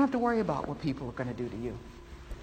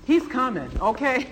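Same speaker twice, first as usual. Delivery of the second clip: excited; 7 semitones higher, 6 dB louder than usual. Real, not acted. Preacher's rising exclamation.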